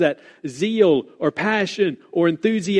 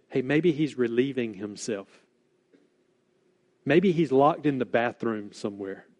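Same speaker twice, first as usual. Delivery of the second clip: mocking; 5 semitones lower, 6 dB quieter than usual. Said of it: "he's laying it on so heavy".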